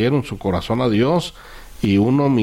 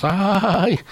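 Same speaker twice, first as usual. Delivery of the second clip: high, sharp gasp of shock; a jolted little cry of surprise; startled ay; 8 semitones higher than usual. Real, not acted. Shocked reaction to killer's confession.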